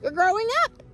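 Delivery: high, bright worms answering; worms' voice. Acted. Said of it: "said the worms".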